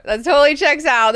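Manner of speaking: "That totally checks out" belted out, high and loud; emphatic loud agreement. Shown as a demonstration. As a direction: shout the agreement with gusto. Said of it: "repeats line, then louder".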